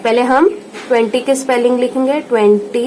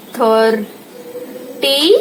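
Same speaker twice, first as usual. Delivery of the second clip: Thirty stretched very slowly; drawn-out dictation; much slower than usual. Shown as a demonstration. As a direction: drag thirty out for the class. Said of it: "ये thirty एथ हो जाएगा".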